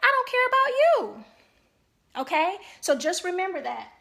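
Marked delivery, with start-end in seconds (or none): 0.0-1.2 high, loud mock voice that brushes the spouse off; dismissive mock quote. Therapist voicing a spouse who has stopped caring, in a high loud dismissive mock voice. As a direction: toss the line off like they don't matter. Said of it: "that type of behavior says".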